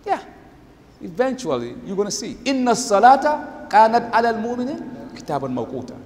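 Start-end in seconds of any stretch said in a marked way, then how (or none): 2.4-5.9 chanted Arabic verse, measured and melodic; Quranic recitation. Acted. Preacher reciting scripture to the congregation, chanted.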